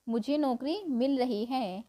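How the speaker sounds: usual speaking voice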